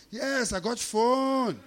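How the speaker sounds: high, loud, slow sing-song; beaming, drawn-out broken-English reply; extra-friendly imitation. Driver proudly answering the customer, extra-friendly sing-song. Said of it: "he's extra friendly".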